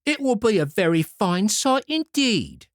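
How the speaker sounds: high, sneering voice; mock the idea with disdain; scornful minx. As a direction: rude daughter scoffing at her mother. Ill-bred daughter sneers.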